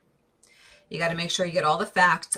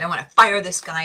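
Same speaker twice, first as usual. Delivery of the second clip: a louder, mock-angry demand to fire him; fired-up client; 8 dB louder than usual. Client on the phone, demanding the painter be fired, mock angry. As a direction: retell the upset client's outburst with some heat. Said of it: "he was really fired up".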